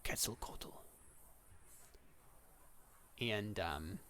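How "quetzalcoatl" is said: hushed whisper of the name; soft, voiceless summons; Death calling by name. Acted. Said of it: "calling him by name".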